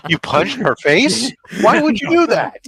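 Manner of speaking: high, loud incredulous questions; laughing disbelief. Real, not acted. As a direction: exclaim in amused shock, pitch leaping up. Reacts to a misheard punch, laughing.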